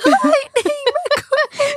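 shrieked TikTok catchphrase; high-pitched shout. Pretend. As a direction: squeal the name up high. Repeats the trend line, very high.

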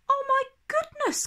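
startled mother catching her breath; gasping. A narrator's reading. Mother seeing Martha return home, surprised gasp. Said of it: "gasps Mother".

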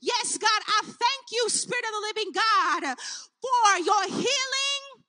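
normal talking voice

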